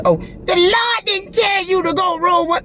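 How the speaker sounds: high, slow mocking whine; mocking doubter. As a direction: mimic a sneering skeptic.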